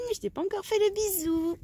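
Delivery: high pet voice. Real, not acted. Cooing to a hen about a kiss.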